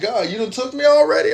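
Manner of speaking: high, stunned cry to God; shocked plea to God. Retold or imitated. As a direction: squeal it up to heaven in disbelief. Shocked prayer after being shot.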